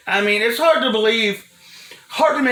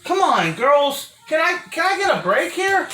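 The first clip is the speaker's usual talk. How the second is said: exasperated plea; 10 semitones higher than usual. Real, not acted. Pleading with interrupting kids.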